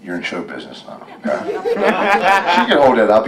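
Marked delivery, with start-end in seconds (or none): 1.5-3.3 laughing. Real, not acted. Laughing at the child holding microphone.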